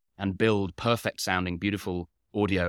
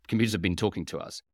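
ordinary delivery

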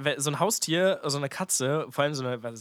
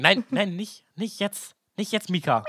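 villain hushing cat; 5 semitones higher, slower than usual. Pretend. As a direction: shoo the cat away, annoyed.

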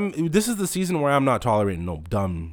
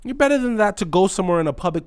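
usual speaking voice

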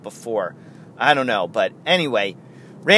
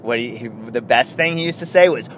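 ordinary delivery

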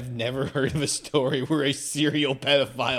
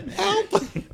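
high squeaky cry for help; panicked kid yelling; 5 dB louder than usual. Pretend. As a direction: squeal it like a tiny scared kid.

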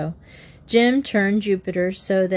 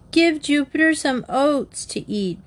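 mild shout in a raised voice; 8 semitones higher than usual. A narrator's reading. Bobby calling to Jim about his horse, mild shout. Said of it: "Bobby shouted".

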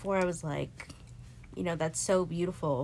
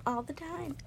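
high, self-pitying plea; high, exasperated question to herself; 11 semitones higher, 6 dB quieter than usual. Retold or imitated. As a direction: wail it at yourself up high. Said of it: "And I was like".

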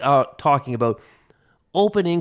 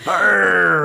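pirate growl; 4 semitones higher than usual. Acted. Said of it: "like a pirate".

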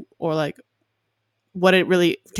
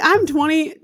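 exasperated; 10 semitones higher, 6 dB louder than usual. Retold or imitated. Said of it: "I'm like".

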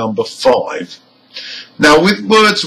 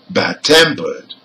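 "Bad-tempered", second part stronger than Bad; stress on TEMPERED; 5 semitones lower than usual. Shown as a demonstration. Teacher repeats the example for learners, "Bad-tempered" stressed on TEM. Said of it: "the stress is on the second part".

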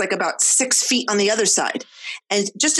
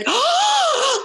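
imitating excited gasp. Mom imitating her little son's excited gasp, high. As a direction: gasp like a thrilled toddler.

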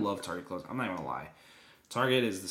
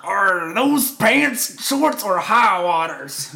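mocking classmate; 7 semitones higher, 10 dB louder, much slower than usual. Classmates mocking his cut pants.

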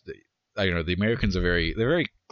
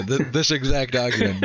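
ordinary talk, nothing marked

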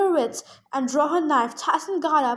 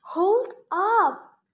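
mild shout, raised in pitch; shouted interruption; 5 semitones higher than usual. A narrator's reading. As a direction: call a halt with a higher, lightly raised voice. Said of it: "Annabeth shouted".

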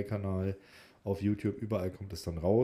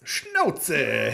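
mock shout; 6 semitones higher, 9 dB louder than usual. Playful mock rebuke of cohost.

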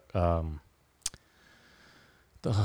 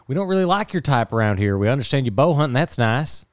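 higher, put-on Texan accent, badly done; a clumsy Texan ombudsman accent, pitched up; terrible accent; 3 semitones higher than usual. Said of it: "that was a terrible accent".

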